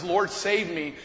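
ordinary talk, nothing marked

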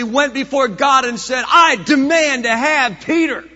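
loud, rising pulpit preaching; preacher builds to a loud climax; full-force preaching. Acted. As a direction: drive it home to the congregation.

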